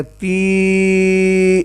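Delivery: تی drawn out as a long steady teeee. Shown as a demonstration. Teacher stretches the vowel so the pupil hears it. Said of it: "لمبا کرنا ہے".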